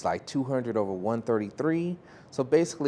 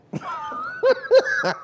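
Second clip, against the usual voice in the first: loud, high cackle; a shrieking laugh at her own joke; high burst of laughter; 7 dB louder than usual. Real, not acted. Laughs after the quote.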